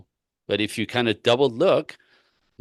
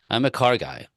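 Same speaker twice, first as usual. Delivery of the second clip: ordinary talk, nothing marked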